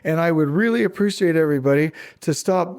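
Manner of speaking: ordinary talk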